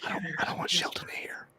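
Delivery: secretive hushed whisper. Real, not acted. Keeping it from Shelton next door, whispering. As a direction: keep it from being overheard. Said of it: "why are we whispering".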